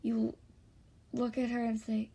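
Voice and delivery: usual speaking voice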